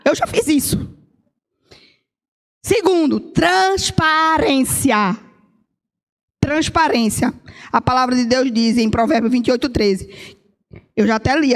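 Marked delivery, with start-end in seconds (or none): 2.6-7.3 very slow, stretched transparência; drawn-out keyword. Real, not acted. Preacher announces her second point, slowly stretched. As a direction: stretch the key word out.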